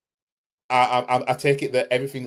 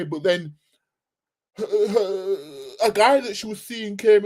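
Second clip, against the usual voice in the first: mocking rival fan; 9 semitones higher than usual. Streamer translates the rival fan's brag, high and mocking. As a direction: squeak it like a bragging lad. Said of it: "let me translate this".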